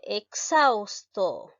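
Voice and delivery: exhausto drawn out very slowly; slow word reading. Shown as a demonstration. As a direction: read exhausto out slowly for the class. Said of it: "Continuamos con la siguiente palabra". (lectura muy lenta de "exhausto")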